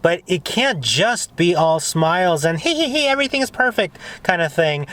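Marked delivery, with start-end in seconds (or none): 2.6-4.0 high, fast, giggly put-on sweetness; mock cheerfulness. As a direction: parody someone who thinks all is perfect, pitched way up. Mocks fake all-smiles cheer.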